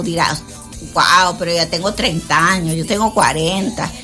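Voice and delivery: usual speaking voice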